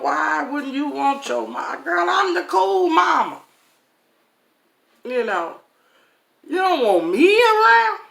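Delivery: high, loud, indignant pleading; hurt disbelief. Retold or imitated. A mother asking her daughter why she's not wanted, high and hurt. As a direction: squeal it out, wounded and baffled.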